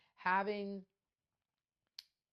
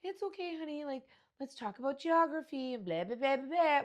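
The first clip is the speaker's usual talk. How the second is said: higher, sugary mock reassurance; mock-sweet mom voice, higher; patronizing sweetness; 9 semitones higher, 8 dB louder than usual. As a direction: talk down gently to a kid. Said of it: "You'd have to, like".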